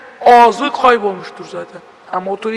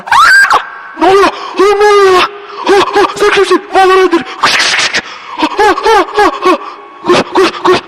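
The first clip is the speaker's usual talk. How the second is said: terrified shrieks; 9 semitones higher than usual. Real, not acted. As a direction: scream like something is right behind you. Chased again, screaming while fleeing.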